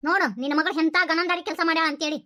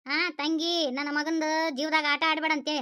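ordinary talk

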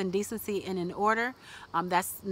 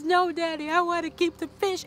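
high, louder mock child's plea; crying little boy; 9 semitones higher, 4 dB louder than usual. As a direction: imitate a little boy begging his daddy. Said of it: "he was crying and crying and crying".

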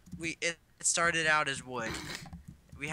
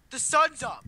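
alarmed shout; 9 semitones higher, 7 dB louder than usual. Real, not acted. Alarm at sunrise in game.

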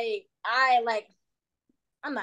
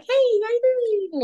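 high, loud, drawn-out greeting; playful little voice; 13 semitones higher, 5 dB louder, slower than usual. Acted. Switching from serious talk to the little voice, high and playful. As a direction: sing it out high and childlike.

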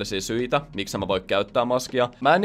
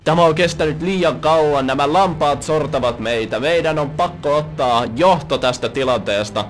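slow, louder heroic rallying voice; mock hero speech; 2 semitones higher, 5 dB louder, slower than usual. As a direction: ham up a self-made hero's call to arms. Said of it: "luulee, että se tekee joku sankariteon".

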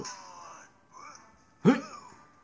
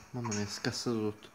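ordinary talk

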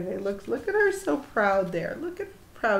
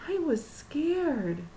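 ordinary delivery, nothing marked

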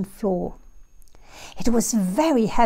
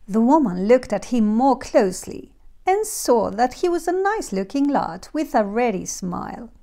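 usual speaking voice

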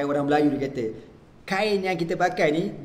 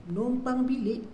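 surprised; 9 semitones higher, 3 dB quieter than usual. Retold or imitated. Preacher reacts to friend's lodging.

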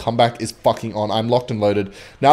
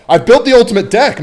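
loud, high gloating boast; excited boast; 10 semitones higher, 10 dB louder than usual. Real, not acted. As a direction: crow about the deck. Boasting after a win.

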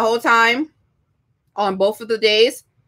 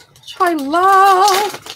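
gushing delight; 9 semitones higher, 4 dB louder, much slower than usual. Real, not acted. Gushing over craft supplies.

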